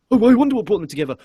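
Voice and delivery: high, quick mocking voice; sneering parody of a clueless question; sarcastic mock wondering. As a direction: feign curiosity, fast and high.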